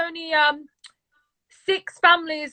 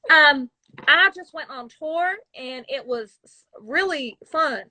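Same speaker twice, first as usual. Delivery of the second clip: put-on slow, careful American accent; slower than usual. English woman attempting her best American, slow American accent. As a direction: try on an American accent, slowly. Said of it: "So my American would be like".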